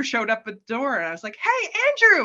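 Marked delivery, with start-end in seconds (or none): 1.4-2.2 bright, much higher, louder hello; delighted "Hey, Andrew"; happy greeting. Retold or imitated. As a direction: greet a friend you're thrilled to see. Greeting Andrew at the door in dream.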